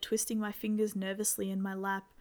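plain reading voice

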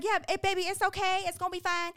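high, tender reassurance; calm the scared child; comforting little boy, lightly high; soothing mother. Retold or imitated. Comforting her little son.

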